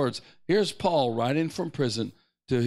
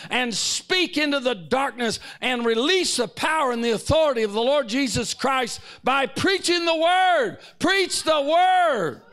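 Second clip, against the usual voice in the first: full-force preaching; 9 semitones higher, 5 dB louder than usual. Real, not acted. Preacher urging church to preach the word, full force. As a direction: thunder the charge from the pulpit.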